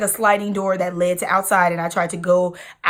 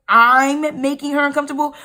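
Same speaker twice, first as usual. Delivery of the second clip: incredulous retort; 6 semitones higher, 5 dB louder than usual. Retold or imitated. Disbelief at dad's accusation.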